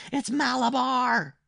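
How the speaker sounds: high, drawn-out scream; powerful strange scream. A narrator's reading. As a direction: scream it slowly in a strange, frenzied child's voice. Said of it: "screamed in a powerful, strange voice".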